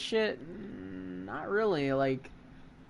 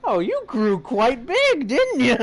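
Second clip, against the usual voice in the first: slow, high, loud granny tease; teasing grandma voice; 9 semitones higher, 8 dB louder, slower than usual. Acted. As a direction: coo it like a cheeky grandma.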